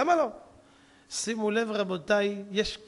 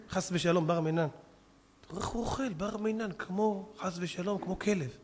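disgusted aside; 3 semitones lower, 9 dB quieter than usual. Acted. Rich wife murmurs disgust at rabbi.

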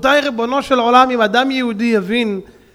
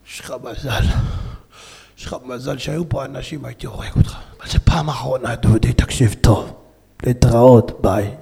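menacing thug; 10 semitones lower than usual. Retold or imitated. Thug threatens beaten passenger.

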